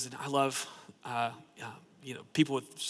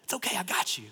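gentle reassurance; 3 semitones higher, 3 dB quieter than usual. Retold or imitated. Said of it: "God's Spirit is like".

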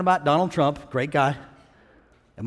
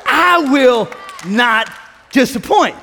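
loud, slow declaration; emphatic preacher quoting God; proclaimed divine promise; 7 semitones higher, 6 dB louder, slower than usual. Retold or imitated. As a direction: boom it out word by word.

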